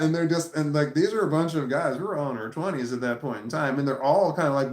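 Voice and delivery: plain reading voice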